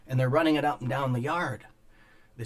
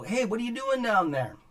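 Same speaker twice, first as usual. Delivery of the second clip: higher, calling down to the yard; retold call from the window, much higher; 10 semitones higher than usual. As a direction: shout the question down to the worker. Calling down to a worker.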